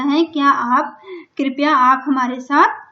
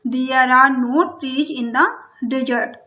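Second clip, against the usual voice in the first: slow dictation; much slower than usual. Shown as a demonstration. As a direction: read it word by word for the class. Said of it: "teacher reads English line slowly".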